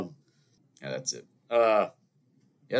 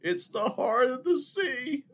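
very high, slow, breathy-ish parody of a swoon; mock dramatic movie line; slower than usual. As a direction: send up the film with a dreamy overblown delivery. Mocking the film's dramatic line.

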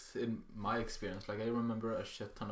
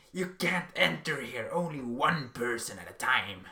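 retold yelling; 3 semitones higher, 8 dB louder than usual. Old woman at Coop yelling at the boys entering the store, loud. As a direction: shout them out of the store. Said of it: "started screaming at us".

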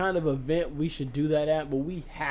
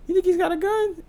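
squeaky friend; 5 dB louder than usual. Retold or imitated. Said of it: "the second one of us will go".

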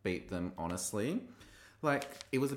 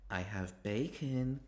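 lightly acted slow deadpan delivery; imitated queen; slower than usual. Queen right up at the camera, slow mock announcement. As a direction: draw the words out slowly for comic effect. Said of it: "And she's like".

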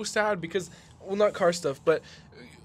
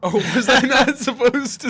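laughing through a teasing question, pitched up; 8 semitones higher, 10 dB louder than usual. Real, not acted. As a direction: giggle it out as you ask. Teasing guess, laughs mid-line.

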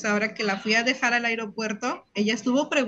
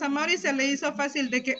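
normal talking voice

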